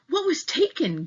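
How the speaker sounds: high, alarmed question; mild gasp of alarm; gasping mom. Acted. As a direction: Mom catches her breath in shock. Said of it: "gasped Mom".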